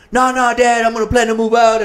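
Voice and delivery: high, loud interruption; urgent protest. Retold or imitated. Son objecting to living at home forever, urgent protest.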